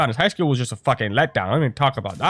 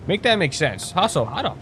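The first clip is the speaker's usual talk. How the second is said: ordinary talk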